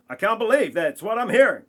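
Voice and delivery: high, fast astonished voice; mock astonished newsreader. Voicing a stunned newsreader on air, high and quick in mock disbelief.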